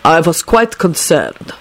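slow, grave formality; lightly voiced solemn concern. A narrator's reading. Dragomir sits back down to address Neville, gravely. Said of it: "Dragomir told Neville gravely".

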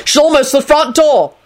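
high, urgent warning; reckless shout. A narrator's reading. Lupin warning Neville someone is coming, reckless shout. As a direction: raise the pitch in a contained shout. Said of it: "Lupin shouted recklessly".

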